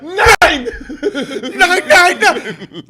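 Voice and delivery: high, loud, panicked laughing yell; hammed-up yelling. Nazi janitor denying blame at Nuremberg, high panicked yell. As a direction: shriek the excuses like a cornered janitor. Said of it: "they're just yelling".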